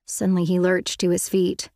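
usual speaking voice

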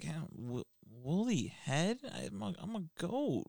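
soft, hesitant, pitch lifting in doubt; mock self-conscious creature, halting and small. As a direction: play a wounded monster, quietly unsure. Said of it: "got very self-conscious".

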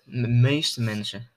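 "meeste" slowed right down and leaned on; slow and deliberate, "meeste" weighted; stress on meeste. Shown as a demonstration. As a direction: lean hard on "meeste", draw the whole phrase out slowly. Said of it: "met nadruk op meeste".

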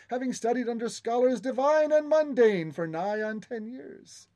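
high, grand, flowery guide voice; bow and flatter them, voice lifted high; oily flattering courtier. Acted.

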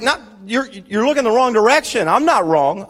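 ordinary talk, nothing marked

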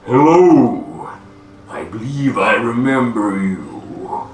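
huge, ponderous creature voice; slow-speaking giant. Acted. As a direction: draw every word out like a mountain giant. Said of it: "he speaks pretty slowly".

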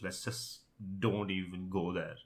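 usual speaking voice

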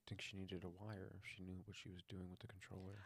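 hushed whisper of the question. Real, not acted. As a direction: lean in and whisper it. Whispered aside to host.

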